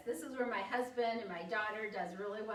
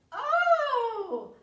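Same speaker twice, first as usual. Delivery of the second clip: loud, high whoop of delight; big, soaring, delighted exclamation; thrilled oh; 13 semitones higher, 13 dB louder than usual. Shown as a demonstration. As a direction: burst out with overjoyed surprise. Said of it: "day that you got your tax refund".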